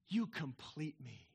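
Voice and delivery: soft, slow romantic murmur; tender declaration. Acted. Man in a movie tells his woman, tenderly. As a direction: say it low and drawn out, like a movie lover.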